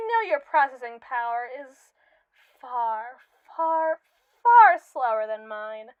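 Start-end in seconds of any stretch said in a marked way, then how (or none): 2.6-5.9 each "far" stronger, the last loud and slow; building emphasis. Acted. AI mocks its creator's slowness.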